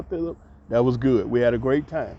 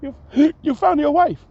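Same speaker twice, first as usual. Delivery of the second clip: excited scream. Retold or imitated. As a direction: squeal with joy. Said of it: "Vida just screamed".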